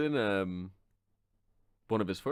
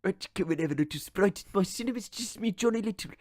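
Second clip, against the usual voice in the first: silly Depp voice; 3 semitones higher than usual. Pretend. Impersonating Depp.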